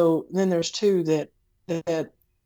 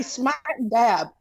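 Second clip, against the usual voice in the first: drawn-out Southern drawl, pitched high; Southern accent; 5 semitones higher than usual. Retold or imitated. Teasing co-host's Southern phrase back to them, in an exaggerated Southern accent. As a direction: stretch it into a slow Southern twang. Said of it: "wouldn't even have to even have a Southern accent".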